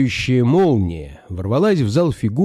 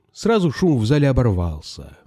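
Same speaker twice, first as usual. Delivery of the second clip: usual speaking voice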